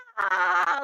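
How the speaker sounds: high squeaky cartoon laugh; a giddy toon cackle; cartoon giggle. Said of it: "He was like".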